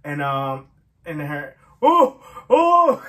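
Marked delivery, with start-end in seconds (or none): 1.8-3.0 high, loud, choked-up cries of "oh"; overcome with emotion. Real, not acted. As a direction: cry out as the feeling hits. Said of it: "I'm gonna get emotional".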